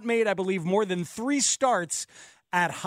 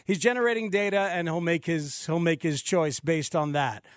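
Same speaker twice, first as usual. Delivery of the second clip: usual speaking voice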